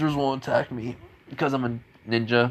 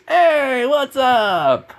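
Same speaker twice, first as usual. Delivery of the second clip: much higher and louder put-on voice; cheerful friend voice; 14 semitones higher, 6 dB louder, much slower than usual. Friend greeting Naruto, high and loud put-on voice. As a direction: pitch it way up like a cheery buddy. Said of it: "He goes".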